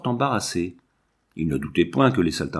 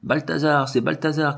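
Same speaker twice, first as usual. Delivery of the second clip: higher, mildly excited call; children crying out; 5 semitones higher than usual. A narrator's reading. Two children recognising their dog, crying out. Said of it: "criaient les deux enfants".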